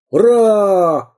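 громкий высокий выкрик; гаркни «ура!» во всё горло; гаркнул. Acted. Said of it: "гаркнул пират". (пират громко гаркает: «Ура!»)

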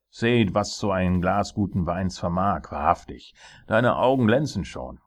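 loud, boisterous royal voice; gloating tyrant king. Acted. King showing off the drunk dwarf, gloating. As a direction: crow over it to the whole court.

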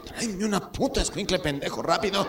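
high-pitched, exasperated grandpa order; abuelo impaciente. Acted. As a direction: repeat it sharply as an impatient grandpa. Grandfather repeats order, now insulting grandson. (orden aguda y exasperada de abuelo)

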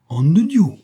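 sudden high loud cry; shocked outburst. A narrator's reading. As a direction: blurt it out in shock. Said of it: "fit le Tarasconnais en pâlissant".

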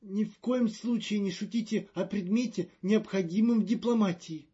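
high, loud and fretful; a jittery official in a panic; nervous diplomat voice. Acted. Magnan protests.

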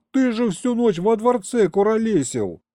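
high-pitched protest; outraged yell. A narrator's reading. Grandson challenging grandpa's alibi, high exasperated cry. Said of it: "заорал я".

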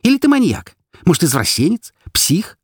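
very high, frantic questioning; agitated, accusing outburst; hurling accusations. A narrator's reading. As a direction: pile on insults, pitched high. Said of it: "сыпала я, задыхаясь обвинениями".

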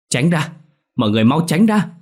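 high urgent shout to clear the way. Acted. Nurse pushing the defibrillator through the crowd, urgent shout. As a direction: yell at the crowd to move. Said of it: "không quên hét lên".

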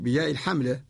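usual speaking voice

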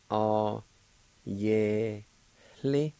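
"Aw, yeah, li" in separate syllables, a breath of H before "li"; faint H before L. Shown as a demonstration. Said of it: "there's a faint H sound right before the L".

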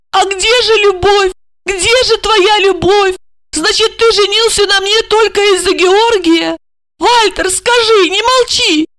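high, distraught pleading questions; plaintive. A narrator's reading. Wife asking where their love went, plaintive. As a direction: wail the reproach, pitched very high. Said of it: "жалобно спросила".

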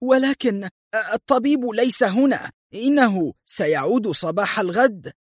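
high-pitched, cowering excuse; acted frightened subordinate; fearful. A narrator's reading. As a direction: explain timidly, pitched well up. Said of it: "تراجعت الحارسة في خوف".

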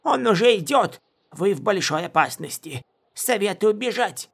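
high put-on voice, indignant warning; hurt pride turned into a high threat; offended. A narrator's reading. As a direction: warn them in a huffy, high-pitched voice. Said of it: "сказал Ву обиженным тоном".

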